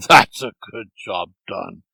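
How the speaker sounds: chuckling, pleased with himself; with a laugh. A narrator's reading. Devil to Erky after the deed, chuckling. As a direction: laugh it out, smug. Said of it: "the devil said with a laugh".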